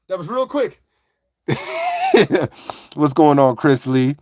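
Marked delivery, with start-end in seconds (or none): 1.5-2.5 very high burst of laughter; high squealing laugh. Real, not acted. Laughs after the shorts joke.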